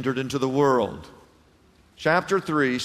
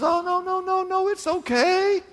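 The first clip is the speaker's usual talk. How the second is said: coaxing Satan. Pretend. Satan voiced, tempting.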